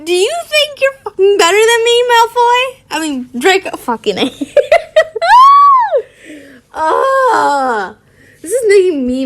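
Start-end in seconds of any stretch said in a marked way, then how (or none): 0.0-2.8 high, indignant acted outburst; furious. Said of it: "Draco was furious". 4.4-6.0 high, shrieking laugh; loud laugh. Real, not acted. Cracks up at own misreading.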